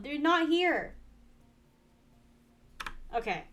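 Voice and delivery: ordinary talk, nothing marked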